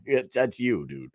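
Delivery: ordinary delivery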